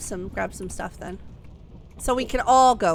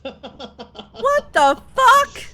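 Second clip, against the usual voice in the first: high, loud, slow laughing outburst; laughing disbelief; 9 semitones higher, 11 dB louder, much slower than usual. Real, not acted. Launch failed.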